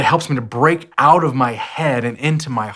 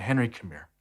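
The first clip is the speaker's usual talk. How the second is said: soft and gentle; 3 semitones lower, 9 dB quieter than usual. Retold or imitated. Dad calms down, softly calls son.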